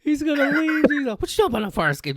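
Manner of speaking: high-pitched, helpless laughing speech; laughing hard. Real, not acted. Cracking up at co-host's story about meeting the actor, laughing hard. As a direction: squeal it out through real laughter.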